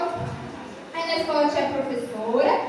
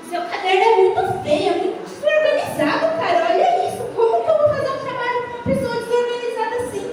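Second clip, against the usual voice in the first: higher, scornful put-down with a laugh; schoolboy rudely mocking his friend's notebook, higher and scornful; 7 semitones higher than usual. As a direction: look down on the messy kid. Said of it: "E ele falava desse jeito".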